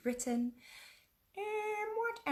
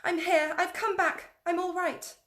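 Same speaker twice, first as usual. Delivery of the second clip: high, loud call of "I'm here!"; loud shouting girl; 12 semitones higher, 9 dB louder than usual. Acted. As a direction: call out to the others in the passage. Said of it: "she shouted".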